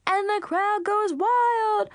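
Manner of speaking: high, stretched-out announcer yell; screamed cheer. A narrator's reading. Boy celebrating his touchdown among the dogs, screaming. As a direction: play your own excited commentator. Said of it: "he screamed".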